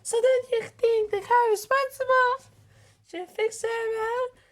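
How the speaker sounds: high, loud demanding question; Sally demanding of the crowd. Acted. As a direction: press them hard. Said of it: "Sally demanded".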